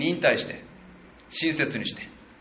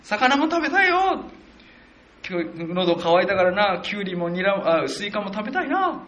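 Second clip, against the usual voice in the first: whiny wail; 7 semitones higher than usual. Retold or imitated. Israelites craving Egypt's food, high whiny mock lament. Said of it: "大声で泣いて、うわ、言ったんですよ".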